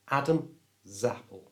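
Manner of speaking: "Adam", pause, then "Zappel" opening on Z; split before Z. Shown as a demonstration. Teacher repeating for learners to copy, split "Adam" and "Zappel". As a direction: repeat "Adam Zappel" in two separate pieces. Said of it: "move the S from the end".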